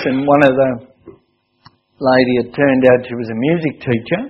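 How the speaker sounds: normal talking voice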